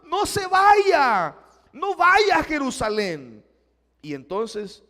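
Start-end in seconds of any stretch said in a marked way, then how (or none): 0.0-3.4 loud, high, slow wailing plea; crying plea. Acted. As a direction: cry out begging him to stay. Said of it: "llorando le decían".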